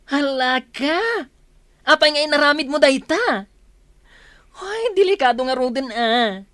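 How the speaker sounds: shocked scolding. Acted. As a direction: gasp and scold her. Friend shocked by the news. (nakigtot a panangbabalaw)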